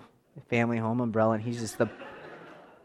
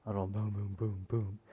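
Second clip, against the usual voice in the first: soft thunder imitation; 5 semitones lower, 11 dB quieter than usual. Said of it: "you can hear a little thunder".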